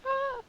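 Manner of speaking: very high little wail; imitated cry. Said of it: "she's still like".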